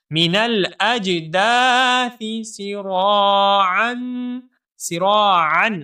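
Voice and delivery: سِرَاعًا with a light nasal ghunna on the ending. Shown as a demonstration. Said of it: "normal سا غنہ ہوگا". (سِرَاعًا میں normal غنہ)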